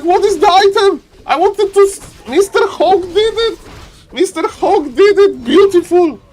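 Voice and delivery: high and loud, still overjoyed; excited yelling. Real, not acted. Caster keeps yelling about the steal.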